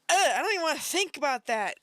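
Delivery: high disgusted groan, quick words; revolted. Real, not acted. Can't bear to think about that, revolted groan. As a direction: recoil from the thought with a loud "ugh".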